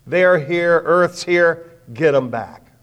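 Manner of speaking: a flight director ordering engineers, loud and urgent; barked orders. Retold or imitated. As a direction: drive the engineers to act. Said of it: "He turns to the engineers and go".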